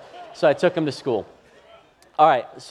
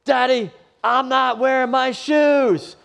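put-on high, slow, whining little boy voice; whiny child; 12 semitones higher, 7 dB louder, much slower than usual. Dad imitates his son refusing to wear his shoes, high and whiny. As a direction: drag it out like a stubborn kid. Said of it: "And then he goes".

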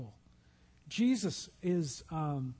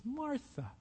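gentle rebuke; 5 semitones higher, 8 dB quieter than usual. Acted. Jesus gently addresses Martha.

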